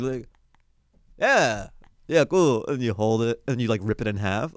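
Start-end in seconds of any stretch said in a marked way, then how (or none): 1.2-2.6 high-pitched forced casualness; mock cucumber-cool nervous actor; fake-cool actor. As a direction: play it cool while secretly terrified. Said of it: "trying to play this cool as a cucumber".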